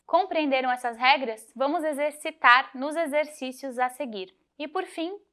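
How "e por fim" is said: normal talking voice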